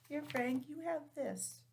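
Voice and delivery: ordinary delivery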